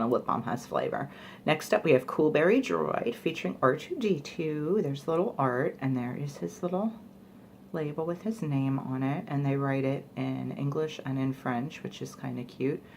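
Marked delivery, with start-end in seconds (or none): none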